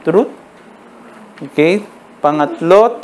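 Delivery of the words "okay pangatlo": ordinary delivery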